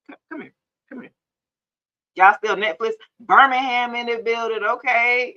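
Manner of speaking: ordinary talk, nothing marked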